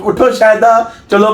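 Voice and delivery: lightly acted classroom command; mock classroom teacher. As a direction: playfully call on a pupil as teacher. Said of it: "classroom में आप सबक सा".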